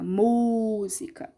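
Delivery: "música" slow, with a lengthened MÚ; stressed first syllable. Shown as a demonstration. Teacher repeats the example, stretched MÚ in "música". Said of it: "eu abro minha boca".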